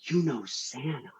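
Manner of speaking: put-on awed child. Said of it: "my business partner's son turns to me and goes".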